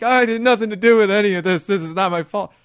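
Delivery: high, loud mock begging; retold desperate whine; pleading. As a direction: plead innocence in a rush. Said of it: "Jonah's pleading".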